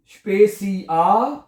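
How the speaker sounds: Spacey आ slowly, each syllable held, rising at आ; Spacey आ as "shpay-see-aah", left open; word broken up. Shown as a demonstration. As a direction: build Spacey आ piece by piece. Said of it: "लफ्ज को तोड़ लें".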